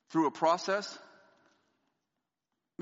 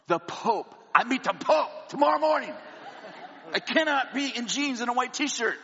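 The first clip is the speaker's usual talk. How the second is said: higher and louder, desperate pleading; frantic pleading; 8 semitones higher, 4 dB louder than usual. Retold or imitated. Pleading with the luggage clerk, frantic and loud. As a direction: beg the clerk, panicking and laughing. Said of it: "I'm like, "No, no, no".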